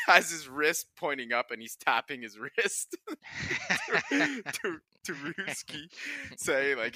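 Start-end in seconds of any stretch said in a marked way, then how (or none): 3.0-4.0 squealing laugh breaking out; high laugh. Real, not acted. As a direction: burst of high-pitched laughter. Laughing at teammate's stunt.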